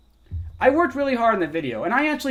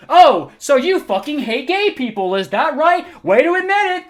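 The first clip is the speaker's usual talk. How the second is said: higher, louder gloating accusation; mocking commenter; 8 semitones higher, 6 dB louder than usual. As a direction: sneer it like a smug accuser.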